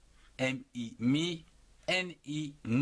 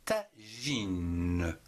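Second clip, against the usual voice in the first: "Tagine" stretched as ta, ji, ne; syllable by syllable; 4 semitones lower than usual. Shown as a demonstration. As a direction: draw out "Tagine" in separate syllables, sounding the final ne. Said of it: "en trois syllabes". (« Tagine » découpé lentement : ta, ji, ne)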